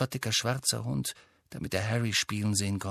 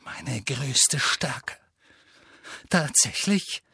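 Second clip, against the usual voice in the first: trembling voice; 2 semitones higher, slower than usual. A narrator's reading. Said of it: "seine Stimme zitterte".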